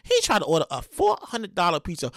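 usual speaking voice

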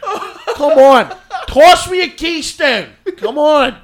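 high, loud, dragged-out Delco whine; funny Delco accent. Acted. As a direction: plead at a shout in thick Delco. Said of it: "it's actually a really funny accent".

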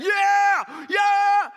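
very high, very loud cheering yell; jacked-up crowd cheering at a pep rally; pep rally shout. Retold or imitated. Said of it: "everybody's jacked".

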